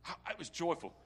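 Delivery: ordinary talk, nothing marked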